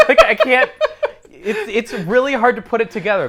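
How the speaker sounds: high, loud laughter breaking through the words; laughing. Real, not acted. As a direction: lose it laughing while trying to talk. Laughing fit mid-sentence.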